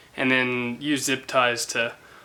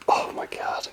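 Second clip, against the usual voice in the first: breathy awed whisper; 5 dB quieter, slower than usual. Real, not acted. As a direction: whisper it under your breath. Awed aside at the lights.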